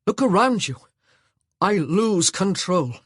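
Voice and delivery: angry. Acted. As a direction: let the confession explode. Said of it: "he said angrily".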